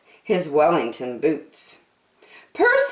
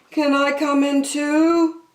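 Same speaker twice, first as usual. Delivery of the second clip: higher, pleading fox; hopeful fox; 9 semitones higher than usual. Acted. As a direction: ask hopefully, a little sly. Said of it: "he asked".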